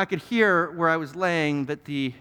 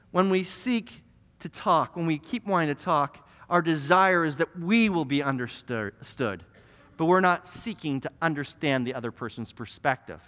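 ordinary talk, nothing marked

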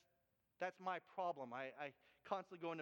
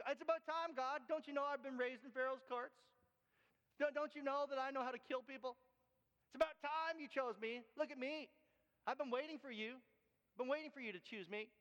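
cocky Moses; 6 semitones higher than usual. Acted. Cocky Moses voiced to God.

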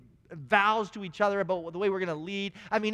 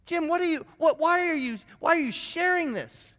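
higher, puzzled, almost pleading; baffled inner thought; 9 semitones higher, 3 dB louder than usual. Retold or imitated. Speaker inwardly questioning a rambling colleague, baffled and raised. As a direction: ask it like you can't believe what you're hearing. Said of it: "I'm thinking to myself".

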